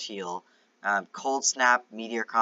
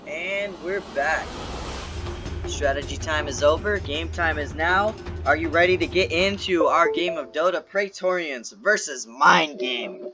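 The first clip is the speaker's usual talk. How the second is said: esports caster; 4 semitones higher than usual. Acted. Hype intro to start the match.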